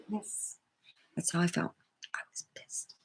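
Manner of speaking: whispered aside. Real, not acted. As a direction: hush it right down to a whisper.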